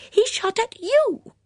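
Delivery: very high, loud, slow insistence; stubborn. A narrator's reading. Dora contradicts her calmer friend, stubbornly insistent. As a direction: refuse to budge, hammering it out high. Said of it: "repeated Dora stubbornly".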